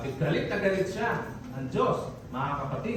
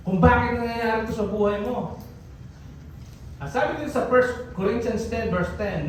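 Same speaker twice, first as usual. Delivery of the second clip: usual speaking voice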